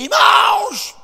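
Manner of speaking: very high, loud preaching cry; pastor preaching. Retold or imitated. Pastor preaching full of God to church, impassioned shout. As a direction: imitate a fired-up little pastor's cry. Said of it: "e pregava assim".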